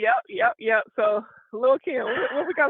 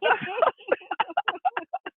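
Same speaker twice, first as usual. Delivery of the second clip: laughing till it squeaks; a high wheezing laugh; high wheezy laugh; 10 semitones higher than usual. Real, not acted. Laughing at Buster story.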